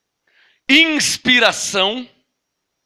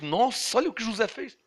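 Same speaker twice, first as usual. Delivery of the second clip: soft, gossipy aside of a reader; hushed wonder; 10 dB quieter than usual. Acted. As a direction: react quietly to the news in the story. Acts a reader's reaction to story. (espanto baixinho de quem lê)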